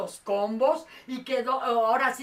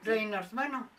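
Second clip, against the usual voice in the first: usual speaking voice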